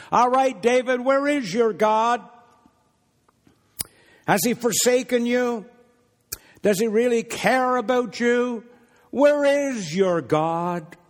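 high, loud jeering voice of the mockers; mock him with a sneer: where is your God; mocking crowd taunting David, loud and jeering. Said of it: "he hears the taunting, he hears the mocking".

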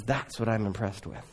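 God quietly praising the humble sinner, hushed; God's hushed approval. Acted.

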